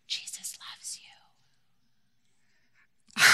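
put-on breathy secret whisper into a child's ear; soft unvoiced whisper to a little girl. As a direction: whisper it tenderly, barely audible. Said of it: "she would whisper into my daughter's ear".

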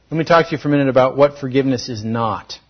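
usual speaking voice

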